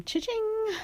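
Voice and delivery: high, slow sing-song cha-ching; drawn-out gleeful cash register call. Pretend. Mocking her money plan with a high cha-ching.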